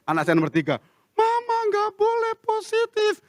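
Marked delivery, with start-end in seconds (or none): 1.2-3.2 put-on very high, slow child's wail; hammed-up youngest child's cry. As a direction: draw out the protest like an upset kid. Youngest child reacts to mother's result.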